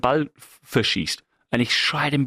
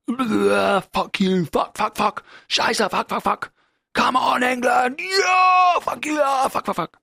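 furious fan swearing; 12 semitones higher, slower than usual. Retold or imitated. Fan swearing at the England match on screen, furious shouting. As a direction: rage at the TV like a hooligan. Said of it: "Und dann fängt das Spiel an".

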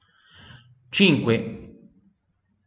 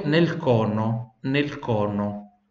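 "nel cono" said very slowly and clearly; slow model; much slower than usual. Shown as a demonstration. Teacher slows down "nel cono" for students. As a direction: drag "nel cono" out for repeating.

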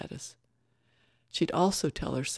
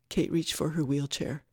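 ordinary delivery

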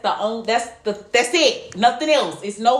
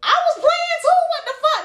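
very high, indignant mock squeal; exasperated retort; 5 dB louder than usual. Woman protesting she was only playing too, exasperated.

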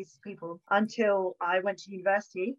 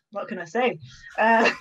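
ordinary delivery

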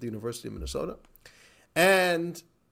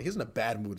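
plain reading voice

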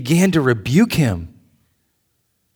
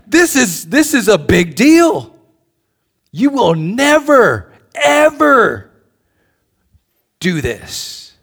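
higher, louder, drawn-out insistence; Peter's emphatic rebuke; 8 semitones higher, 5 dB louder, slower than usual. Retold or imitated. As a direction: plead it like Peter, over the top. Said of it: "So he's like".

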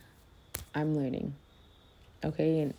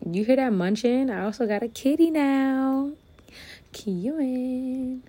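excited; 8 semitones higher, 5 dB louder than usual. Real, not acted. Excited about her new kitty.

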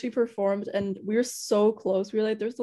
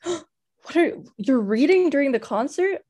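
higher, louder, amazed question; hammed-up fanfic hero spotting her; gasping surprise; 5 semitones higher, 4 dB louder than usual. Mysterious singer on stage spots the reading girl, amazed. Mysterious man in fan fiction.

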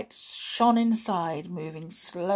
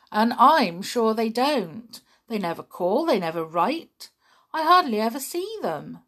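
louder, insistent; upset protest; 4 semitones higher, 7 dB louder than usual. Acted. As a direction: push back hard, hurt. Boy protests about parents.

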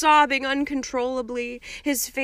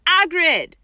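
louder, higher call through a door; 8 semitones higher, 8 dB louder than usual. A narrator's reading. Harry calls to Hagrid through the cabin door, loud. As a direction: call out to someone inside. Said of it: "called Harry".

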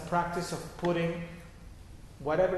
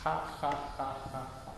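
soft ha ha; 3 semitones lower, 7 dB quieter than usual. Shown as a demonstration. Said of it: "Just continue making".

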